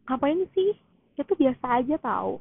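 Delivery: high, loud, brushing it off; dismissive friend. Retold or imitated. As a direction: scoff like it's no big deal. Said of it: "mereka pasti bilang kayak".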